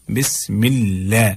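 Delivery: "बिस्मिल्लाह" with light l; thin lam. Shown as a demonstration. Teacher modelling the rule, "बिस्मिल्लाह" with thin lam. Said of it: "बारीक पढ़ना है".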